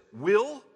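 "will" set apart, high and loud, rising; emphasis on "will". Shown as a demonstration. Preacher pointing listeners to a key word in the verse, emphatic "will". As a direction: lift and punch the word "will". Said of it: "watch this".

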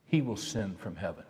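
slow, quiet, low reading of a psalm; solemn scripture reading. Acted. Pastor reading a psalm to the congregation, slow and hushed. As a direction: let each line of the psalm settle softly.

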